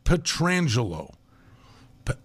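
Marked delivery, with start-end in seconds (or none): 0.0-1.1 Petrangelo as puh-TRAN-juh-lo, slow; corrected name pronunciation. Shown as a demonstration. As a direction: stretch out Petrangelo with TR run together. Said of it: "His name is Petrangelo".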